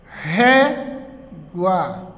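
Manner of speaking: "Régua" split, with a long strong ré, then gua; stressed penultimate syllable. Shown as a demonstration. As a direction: hit and hold ré, pause, drop on gua. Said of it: "Penúltima sílaba mais forte". ("Régua" em sílabas, com o ré mais forte)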